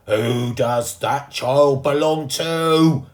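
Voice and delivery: slow, very loud bellow; angry shout. A narrator's reading. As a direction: demand an answer from the crowd. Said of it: "shouted the fiery furry man".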